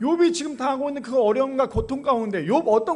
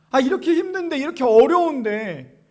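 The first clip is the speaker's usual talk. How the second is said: mock exasperation; 3 semitones higher, 7 dB louder than usual. Tempter to believer in hardship, exasperated insistence. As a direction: lean hard on the hardship while acting it out.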